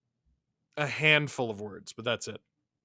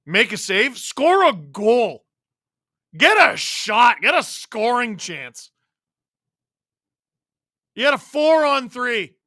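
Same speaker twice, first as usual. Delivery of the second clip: raised, higher, drawn-out fan frustration; real exasperated sports-fan yelling; exasperated rant; 9 semitones higher, 7 dB louder, slower than usual. Real, not acted. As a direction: plead loudly with a useless team. Fan berating his failing team.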